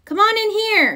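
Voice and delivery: lightly voiced calling cub. A narrator's reading. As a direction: call her into the garage. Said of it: "called Lizzie from the garage".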